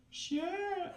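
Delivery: high, mock-flirty "Sure"; a hammed-up coy reply, pitched high. Woman answering a caller, in a mock flirty voice. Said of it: "you reply in your best flirty voice".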